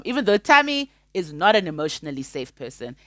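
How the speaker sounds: ordinary talk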